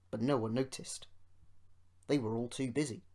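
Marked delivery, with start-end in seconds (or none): none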